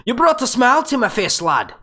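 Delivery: sudden high, loud burst of a stage Irish brogue; Irish accent. Acted. Thanking a viewer for his super chat in an Irish accent. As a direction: blurt it out in a hammed-up Irish accent. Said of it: "the first one that pops in my head is the Irish one".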